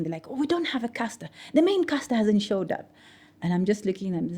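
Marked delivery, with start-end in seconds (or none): 0.3-2.9 mock panicked colleagues, pitched up; higher, mildly panicky retelling; frantic panic. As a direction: imitate a flustered newsroom in mild panic. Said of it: "getting all frantic and they're panicking".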